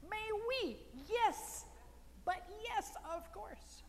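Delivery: very high, exclaimed yes; energetic response. Shown as a demonstration. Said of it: "It's an energetic response to life".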